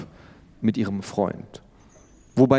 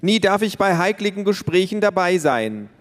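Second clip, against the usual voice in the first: put-on little girl protesting to mother, whiny; whiny cry; 10 semitones higher than usual. A narrator's reading. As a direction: protest like a wronged little kid. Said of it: "rief Tiffany".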